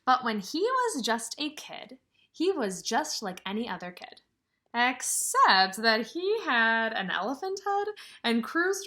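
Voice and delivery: lively storybook reading; reading to children. Acted. Teacher reading a picture book to her class, lively storybook voice. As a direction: read it out to the kids with some sparkle.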